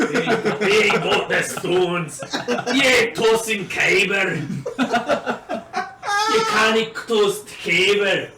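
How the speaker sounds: loud, high, slow mock Scottish brag with laughs; mock Scottish boast.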